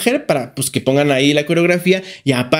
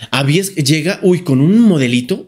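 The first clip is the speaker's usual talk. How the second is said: ordinary talk, nothing marked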